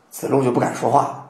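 ordinary talk

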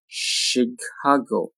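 "Chicago" slowly, syllable by syllable; slow careful "Chicago"; drawn-out model. Shown as a demonstration. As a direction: stretch "Chicago" out for learners. Teacher models word slowly, then normally.

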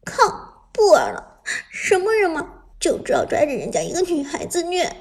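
high, sulky angry complaint; pouting, hurt and angry; resentful tearful girl. Acted. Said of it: "恨恨道".

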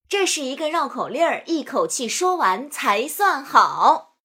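slow, emphatic finishing lines; drawn-out recitation ending. Shown as a demonstration. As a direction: stretch out the closing line. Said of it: "将数枣练习连做三遍".

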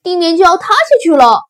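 loud, high, rushed warning; timid rabbit shrieking the alarm; panicked shout. Acted. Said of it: "胆小兔叫道". (惊慌地高声急喊)

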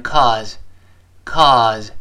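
"cause" as "calls" with a faint, short final z; barely heard ending. Shown as a demonstration. Teacher shows fast reading, "cause" with barely audible final z. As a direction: let the end of "cause" almost vanish. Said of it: "我们读快的时候几乎听不清了".

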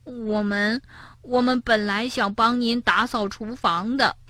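soft, hesitant apology; falter, then explain softly; ashamed. A narrator's reading. Tiaotiao explaining to granny, ashamed. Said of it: "蹦蹦和跳跳羞愧地低下头".

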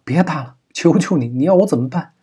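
high-pitched, pleading cry; tearful wail. A narrator's reading. Pleading with the kidnapper to stop the beating, crying out. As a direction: beg as if breaking into tears. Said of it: "王若思失声哭道".

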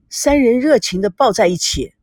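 ordinary delivery, nothing marked

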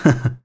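short, low chuckle; amused chuckle. Acted. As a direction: chuckle lightly at the bandits. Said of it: "萧平浪笑盈盈地". (笑盈盈地轻笑)

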